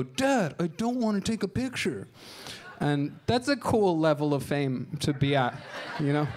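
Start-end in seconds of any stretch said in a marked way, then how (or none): 0.0-2.1 put-on higher, whining child's voice; a bored kid refusing his dad; whiny reluctant kid. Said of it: "And the kid would go".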